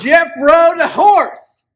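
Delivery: slow, loud cowboy drawl. Acted. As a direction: stretch it out like a cowboy. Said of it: "you got the best cowboy voice".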